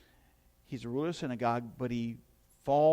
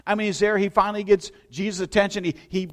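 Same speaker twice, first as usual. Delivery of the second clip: louder and higher, pressing forward; preaching at full force; 5 semitones higher, 8 dB louder than usual. Acted. Pastor preaches Jairus's plea to the congregation, loud and high. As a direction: drive the scene home to the pews.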